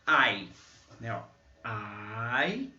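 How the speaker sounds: I said as eye, like the alphabet; teacher isolating the sound; letter sound demo. Shown as a demonstration. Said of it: "como no alfabeto".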